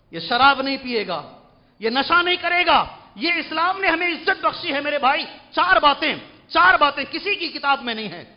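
full-force sermon; preaching at full force. Acted. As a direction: preach it proudly to the congregation. Fervent preaching.

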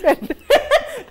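loud high laugh bursting out; burst of laughter. Real, not acted. Mother laughing loudly at her daughter's joke.

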